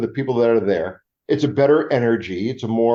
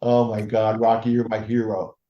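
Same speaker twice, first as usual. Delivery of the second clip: ordinary talk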